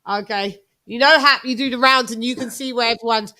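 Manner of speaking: ordinary talk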